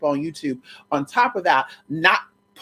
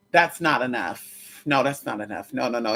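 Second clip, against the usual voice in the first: firm refusal; 6 semitones lower, 4 dB quieter than usual. Retold or imitated. Refusing the client's offer.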